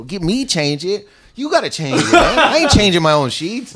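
high-pitched, laughing indignation; retold indignation. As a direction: replay the outrage with your pitch jumping up. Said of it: "I was so mad".

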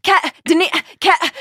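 high-pitched calling out, cycling through names; imitating a mother groping for the right name; flustered mom imitation. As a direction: fire off names as a muddled mom. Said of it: "My mom goes like".

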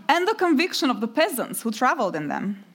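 measured, slightly lifted reading voice for an audience; memoir reading. Acted. Author reading her memoir to a literature audience, measured and lightly amused. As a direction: read the page aloud to the hall, lightly amused.

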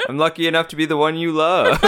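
laughing through the words. Real, not acted. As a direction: laugh while saying it. Laughing reply.

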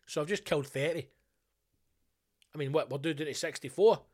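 usual speaking voice

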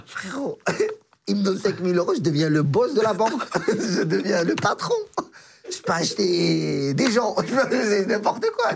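slow words broken by giddy laughter; laughing. Real, not acted. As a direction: laugh through the dream of being rich. Laughing at five thousand euros.

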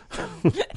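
high airless strained squeak. Real, not acted. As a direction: squeeze out a high breathy squeak. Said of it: "You sound like you're trying to push out a shit".

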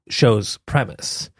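ordinary delivery

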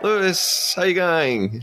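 put-on a light stab at an Australian accent; Australian accent attempt. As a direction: greet him with a hammy Australian twang. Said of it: "my Australian accent attempts".

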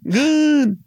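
very high, squealing comic complaint; mock upset. Host arguing for icing on cake, squealed mock upset. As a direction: whine in a put-on high voice, hammed-up.

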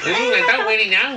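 high Scouse copy slipping into Manc; attempted Scouse. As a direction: echo the Scouse, drifting towards Mancunian. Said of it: "that was a bit mank".

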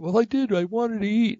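put-on very high, indignant child's whine; protesting grandkid. Grandson insists to grandpa he wanted to eat, very high whine. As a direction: squeak it out like a stubborn little boy.

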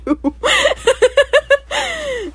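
high, long cackle; laughing fit. Real, not acted. Host amused by the painful lyric, laughing. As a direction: laugh helplessly.

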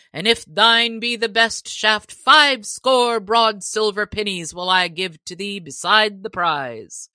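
a hint of a proud sheriff promising a reward. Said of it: "cried the sheriff".

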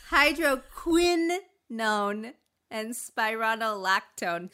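slow hydro-KWINN-own and spy-RON-oh-lactone; exaggerated syllables. Shown as a demonstration. Reader showing she has it, slow overstressed hydroquinone and spironolactone. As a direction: hydroquinone and spironolactone painstakingly sounded out. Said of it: "emphasize qui so bad".

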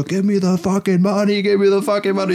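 mock yelled demand. Thug at the car door demanding money, hammed-up shouting. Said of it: "schreien uns an".

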